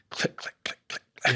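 quiet, voiceless rapid clicks; whispered string of clicks; mock mechanical clicking. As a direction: jokingly imitate the clicking sound. Jokingly mimics the story's clicking.

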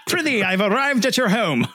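high theatrical Shakespearean voice; hammy stage actor. Pretend. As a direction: ham it up like a door-to-door Shakespeare. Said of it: "The door-to-door Shakespeare".

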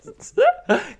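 squealing laugh, loud and high; a sudden high cackle; high burst of laughter. Real, not acted.

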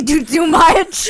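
loud laughing line. Retold or imitated. Laughing as she tells the friend about the date. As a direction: laugh it out while telling.

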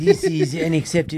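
a hint of a stuffy French accent; mock French accent. Cohost echoes the joke in a mock French accent. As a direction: echo it in a light French accent. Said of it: "do it in a French accent".

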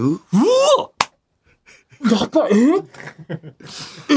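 imitated starstruck fan, high-pitched; アイドルに会ったファン. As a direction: gasp and squeal like you just met an idol. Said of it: "アイドルの感じや". (アイドルに遭遇したファンの興奮の真似)

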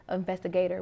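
normal talking voice

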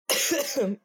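sharp real cough; coughing fit. Real, not acted. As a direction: cough hard, then recover. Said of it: "Sorry, now I'm coughing".

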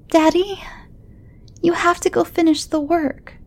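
put-on high, pleading child on the edge of tears; a scared kid begging his dad; upset little boy. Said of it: "lower lip was beginning to quiver".

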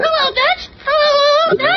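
very high talking-bird voice; parrot squawk. Acted. Parrot interrupts the men's discussion, high parrot squawk. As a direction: squawk the stock phrase like a parrot. Parrot repeats its stock phrase.

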